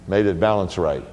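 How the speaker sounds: ordinary delivery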